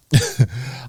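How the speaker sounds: short amused chuckle at himself. Real, not acted. Chuckling at his own cockiness while telling viewers. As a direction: laugh it off, a little embarrassed.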